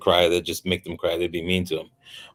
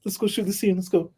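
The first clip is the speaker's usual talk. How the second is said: high, quick urging to start the scene; eager call to shoot; 10 semitones higher, 4 dB quieter than usual. Retold or imitated. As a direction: hurry the crew along. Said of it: "it's like".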